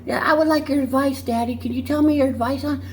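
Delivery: put-on high, girlish daughter voice asking Daddy. The daughter asks her dad for advice in a high girlish voice. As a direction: squeak it out like a little girl.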